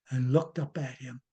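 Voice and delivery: ordinary delivery, nothing marked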